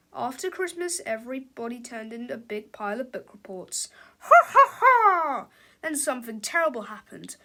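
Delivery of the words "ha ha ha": loud, high mock cackle; hammed-up evil ha-ha; villain laugh. A narrator's reading.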